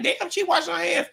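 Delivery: high innocent puzzled voice; naive surprise. Retold or imitated. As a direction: play your clueless younger self. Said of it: "I was like".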